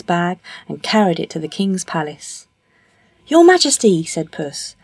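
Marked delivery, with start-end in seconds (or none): 3.3-4.0 high, loud address to royalty; courtly cat. Acted. Puss greeting the king at the palace, courtly. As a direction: hail the king in a raised cat voice.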